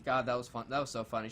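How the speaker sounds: ordinary delivery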